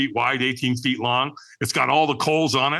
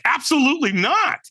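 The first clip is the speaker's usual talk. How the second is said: high, emphatic knee-jerk no; blurted refusal; 11 semitones higher than usual. Retold or imitated. Reluctant man answering an invitation to walk, blurted refusal. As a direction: blurt out a flat-out refusal, pitched up. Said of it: "I just blurted it out".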